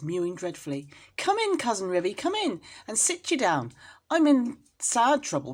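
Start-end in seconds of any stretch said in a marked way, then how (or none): 1.2-5.5 higher, louder anxious plea; an upset mother calling her cousin in; fretful tearful welcome; distressed mother cat. A narrator's reading. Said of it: "shedding tears".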